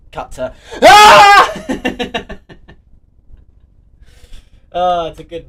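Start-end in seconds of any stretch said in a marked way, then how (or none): 0.8-2.4 long, high, loud "ah!" scream; high-pitched yell breaking into laughter; comic scream. Acted. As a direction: scream it out suddenly for the gag. Cut-to gag after screwdriver rant.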